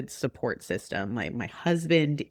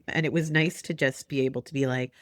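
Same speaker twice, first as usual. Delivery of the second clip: ordinary talk, nothing marked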